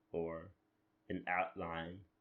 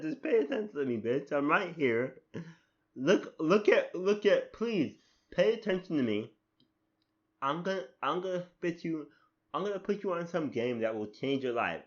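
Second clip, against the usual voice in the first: pleading for attention, louder and higher; Navi; 7 semitones higher, 7 dB louder than usual. Acted. Mock fairy nags the player to listen, exasperated pleading. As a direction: badger the listener insistently. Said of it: "basically all she said".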